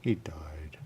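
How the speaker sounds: hushed whisper. Real, not acted. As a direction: drop to a whisper for the sad news. Hushed news of a death.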